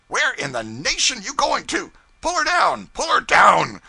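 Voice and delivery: very high, loud frantic yell; yelling pilot. A narrator's reading. Pilot bellowing at the cub steering, very high and loud.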